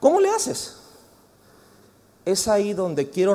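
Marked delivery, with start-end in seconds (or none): 0.0-0.8 mock bewildered. Rhetorical question to the congregation.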